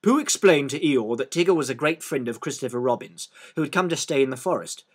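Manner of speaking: plain reading voice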